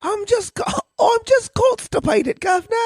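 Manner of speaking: high, slow mock English accent; hammed-up English accent, plummy and silly. Joking podcast host fakes an English accent for his co-host. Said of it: "That was my attempt at a, at an English accent".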